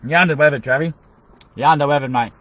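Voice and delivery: loud called-out line; calling out. Acted. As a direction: call it out across the room. Repeated phrase called out.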